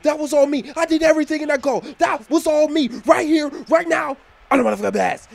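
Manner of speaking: high, loud, boastful shouting; cocky star player. Acted. Real Madrid player demanding the ball and claiming the goal, cocky shouting. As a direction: crow like a show-off striker.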